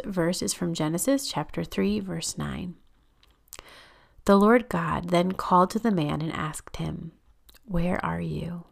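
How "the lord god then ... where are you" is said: unhurried, reverent reading with space between phrases; slow scripture reading. Real, not acted. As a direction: read the verse slowly, softening on the question. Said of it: "I'm just gonna read it really slowly".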